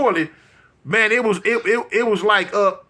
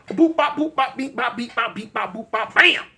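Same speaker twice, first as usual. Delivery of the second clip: fight sound effects; 3 semitones higher than usual. Retold or imitated.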